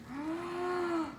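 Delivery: sharp high gasp of delight. Real, not acted. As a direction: catch your breath in wonder. Seeing the plated dish.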